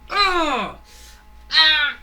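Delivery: high, very loud screams of pain. Retold or imitated. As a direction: scream as if kicked hard. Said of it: "It's like".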